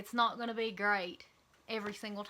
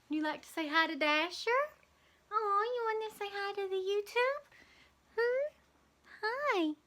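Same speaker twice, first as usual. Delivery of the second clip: pet talk to dog; 9 semitones higher than usual. Real, not acted. Vlogger coaxing her dog Dasher to greet viewers, baby talk. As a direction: coo at the puppy to say hi.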